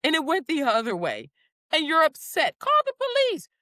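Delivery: rising outraged are-you-insane rant; incredulous scolding. Retold or imitated. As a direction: scold them like they've lost their mind. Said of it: "and it's like".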